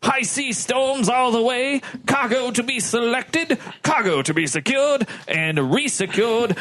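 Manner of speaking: hammed-up weary officer recounting disasters; exasperated ship's lieutenant. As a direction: throw up your hands over a cursed voyage. Reads exasperated lieutenant's testimony in role.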